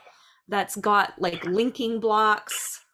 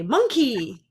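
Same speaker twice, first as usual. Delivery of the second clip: calling out; 3 semitones higher, 4 dB louder than usual. Retold or imitated. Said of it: "So she would say".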